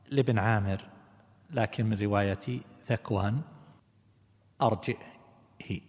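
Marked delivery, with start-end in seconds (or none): 4.6-5.8 "أرجئه" slowly as arji'-hi; hamza, short kasra. Shown as a demonstration. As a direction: glottal stop in "أرجئه", then hi with an i. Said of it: "بالكسر".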